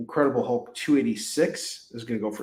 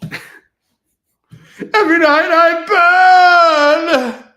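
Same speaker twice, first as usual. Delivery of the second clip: high, loud, slow wailing screech; hammed-up Crow impression; 13 dB louder, much slower than usual. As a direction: wail it like a tormented ghost. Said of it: "I'm doing my Crow impersonation".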